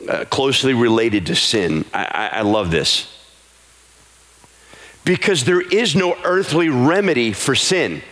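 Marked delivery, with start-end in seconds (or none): 5.0-8.0 high, slow, sung preaching over music; rising revival preacher chant; full-force preaching. Acted. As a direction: lift the congregation into a sung plea. Preaching builds into sung appeal.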